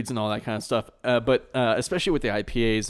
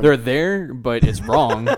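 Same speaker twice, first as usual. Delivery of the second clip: laughing; 8 dB louder, much slower than usual. Real, not acted. Laughing mock disapproval.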